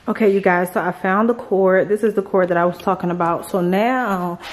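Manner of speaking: usual speaking voice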